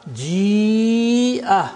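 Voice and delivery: "ji'a" as jiiii-a; lengthened vowel. Shown as a demonstration. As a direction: hold the i of "ji'a" very long, then break into a. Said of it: "So the vowel is lengthened".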